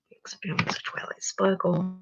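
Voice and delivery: barely voiced whisper. Real, not acted. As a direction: read it to yourself in a whisper. Reading tab name to herself.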